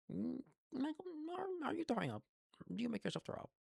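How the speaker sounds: very high-pitched mom voice; mother imitation. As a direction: squeak it like a worried mom. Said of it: "my mom just goes".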